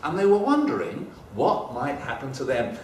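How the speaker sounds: ordinary delivery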